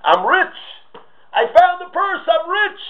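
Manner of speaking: high, loud gleeful yell; screaming with joy. Acted. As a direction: shout it out like you just struck it rich. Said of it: "she hears somebody screaming".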